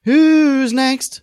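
high, loud announcer's call. Pretend. Host summoning the next listener review, calling out. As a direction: sing it out to bring on the next one.

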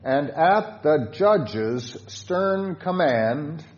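very slow, deliberate hymn line; slow stern recitation; slow solemn reading. Shown as a demonstration. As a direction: draw out every word sternly. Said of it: "Stanza four".

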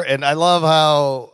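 usual speaking voice

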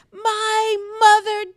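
high, loud retold scream. Yelling at maskless men on the sidewalk, high loud scream. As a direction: scream the accusation at them. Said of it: "I'm screaming at them".

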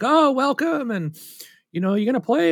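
high, bright mock greeter voice; cheery welcome. Gamers greeting the nervous newcomer, high cheerful imitation. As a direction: lift the voice way up like an eager shopkeeper.

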